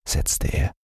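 ordinary delivery

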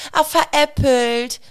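high, drawn-out triumphant tease; boy revealing his prank, high and slow; gloating kid. Retold or imitated. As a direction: crow it slowly like a proud little prankster. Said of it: "child gloats after fake injury".

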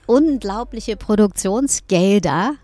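loud, emphatic outrage over wasted money; indignant insistence. Real, not acted. Singer tells doubting interviewer her budget was blown, indignant and loud.

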